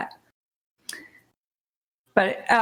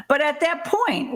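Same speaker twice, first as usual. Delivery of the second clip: ordinary delivery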